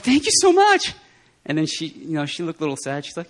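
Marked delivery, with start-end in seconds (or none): 0.0-0.9 high, bright burst of thanks; pitched way up in thrilled thanks; gushing thanks. Retold or imitated. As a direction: gush your thanks up high. Thanking her in the story.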